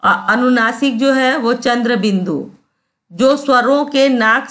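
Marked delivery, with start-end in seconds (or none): none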